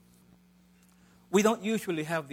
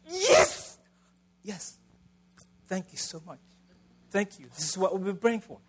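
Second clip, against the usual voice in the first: overjoyed reply; 3 semitones higher than usual. Pretend. Zechariah answering the angel, mock overjoyed. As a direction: burst out in delight, then gush thanks. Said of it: "Actually, that's not what he said".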